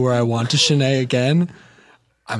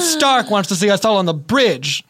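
high-pitched call to the crew; urgent announcement; 6 semitones higher than usual. Acted. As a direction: call everyone to attention. Summons crew to the bridge.

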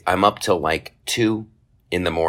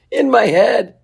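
laughing; 6 dB louder, slower than usual. Real, not acted. Laughing at own joke.

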